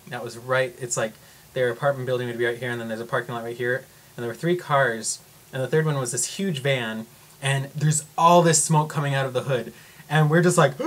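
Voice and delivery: ordinary talk, nothing marked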